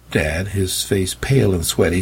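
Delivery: usual speaking voice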